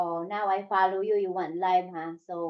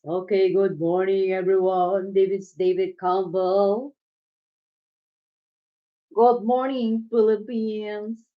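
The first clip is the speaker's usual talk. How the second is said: lightly voiced radio announcer greeting; a hint of a morning DJ; radio host voice; 5 dB louder than usual. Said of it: "you have a perfect radio voice".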